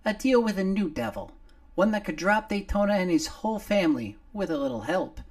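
a little face on a shoulder gulping fresh air; little Nomar. Acted. Nomar freed from under the clothing, high and loud.

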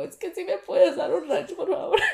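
high, strained voice on the edge of tears; near-screaming plea. Retold or imitated. Panicked girl begging the cashier for ranch, high and strained. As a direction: ask the cashier while barely holding it together. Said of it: "Yo estaba gritando".

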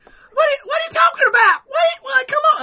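very high, loud Southern woman impression; badly faked, piercing female Southern voice. Shown as a demonstration. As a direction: shriek it an octave up with a twang. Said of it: "it's just pitched up an octave".

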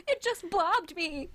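high, slow squealing report of the octopus; friend's squeal. Retold or imitated. Friend screaming about an octopus, high slow squeal. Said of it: "She's like".

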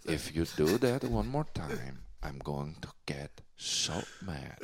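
mock menace that fades into a whisper; menacing hush. Threatens guest over the mic.

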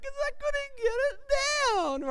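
put-on high, fast little-girl whimper; imitating daughter. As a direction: squeak it out like a tearful four-year-old. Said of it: "And she's like".

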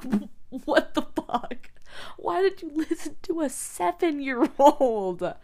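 loud, high, slow mock outrage; hammed-up furious mom yelling; shocked angry mom. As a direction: imitate mom's horrified outburst. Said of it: "And she's like".

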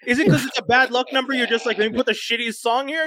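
high mock-exasperated rant; exasperated complaining; incredulous rant. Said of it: "and I was like".

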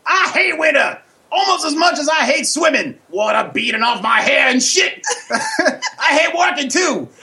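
put-on high, shouting comic old-timer bit. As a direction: bellow it as a rowdy comic character. Host plays his loud character Pops.

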